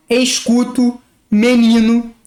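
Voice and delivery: escuto as "eskutu", menino as "meninu"; e kept as e. Shown as a demonstration. Teacher showing the other region's escuto and menino. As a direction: keep each e clear. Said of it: "Tem outros lugares em que as pessoas falam".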